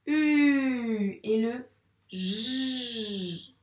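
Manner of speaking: U long, loud and falling, J a long "zh"; sounded-out letters. Shown as a demonstration. Teacher sounding out letters before blending, U and J held long. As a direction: stretch U and J each on its own. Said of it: "Cette lettre, elle fait quel son".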